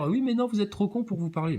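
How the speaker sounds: high mock-snooty voice; mock snooty quote. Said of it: "puis dire".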